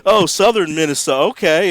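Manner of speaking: smooth over the blunder with charm; imitating the host rolling with it; smarmy lounge singer. Quoting Nick recovering the bit.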